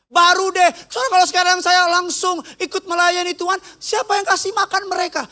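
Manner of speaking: high-pitched mock pleading to a pastor; excuse-making churchgoer. Parent putting off ministry to the pastor, high mock pleading. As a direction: whine out excuses, pitched well up. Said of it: "ngomong gini sama saya".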